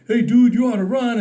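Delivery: loud jokey fireman egging him on; joking buddy call. Retold or imitated. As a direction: rib him like one of the guys.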